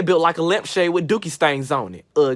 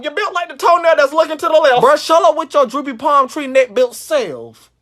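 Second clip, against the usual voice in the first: loud roast; 5 semitones higher, 6 dB louder than usual. Acted.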